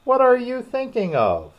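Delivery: high, loud scolding question; severe. A narrator's reading. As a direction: demand it sternly, pitched right up. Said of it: "said the mouse to Alice severely".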